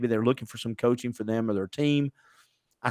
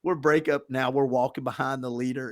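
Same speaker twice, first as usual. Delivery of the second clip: normal talking voice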